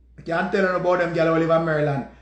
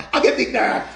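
ordinary delivery

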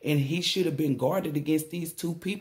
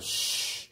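hush; 7 dB quieter than usual. Retold or imitated. Hushing sound in retold quote.

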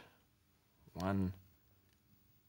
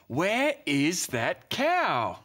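slow, drawn-out puzzled voice; puzzled farmer; 5 semitones higher, much slower than usual. Acted. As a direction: wonder aloud where the cow went. Farmer wonders where cow is.

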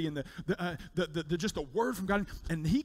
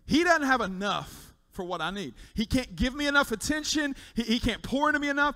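loud, high, pressing pulpit voice; preaching at full force; 6 semitones higher, 8 dB louder than usual. Acted. Pastor voicing the wife's complaint, loud preaching. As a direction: drive each line harder to the back row.

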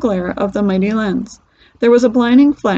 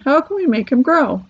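higher, mild plaintive wail; 5 semitones higher than usual. A narrator's reading. Mother asks how to regrow her son, mildly wailing. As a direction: plead with a touch of despair. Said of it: "wailed Missus Teavee".

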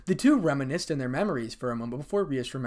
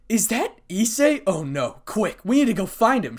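loud, high urgent alarm; shouted; 8 semitones higher, 7 dB louder than usual. A narrator's reading. Akeno rallying friends after hearing a roar, shouted. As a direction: call out in alarm and rally everyone. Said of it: "shouted Akeno".

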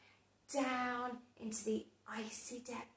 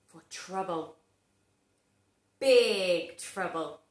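ominous build; 5 semitones lower, 3 dB louder than usual. A narrator's reading. Narrator teasing children, lower and ominous. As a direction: draw out the mystery.

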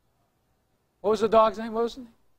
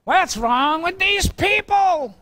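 mock husband scolding; 7 semitones higher, slower than usual. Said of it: "I was like".

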